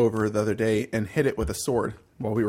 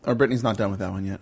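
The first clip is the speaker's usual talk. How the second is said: usual speaking voice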